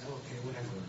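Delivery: low, soft, shrugged-off reply; mildly dismissive, tossed off quietly; retold dismissal. Young believer shrugging off the Lord's mountain vision, dismissive.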